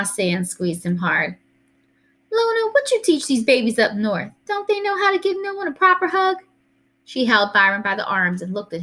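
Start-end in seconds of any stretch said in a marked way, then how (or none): 2.3-6.4 high-pitched, mock-indignant grandma voice; scolding Southern grandma. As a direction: chide them as the old Southern granny.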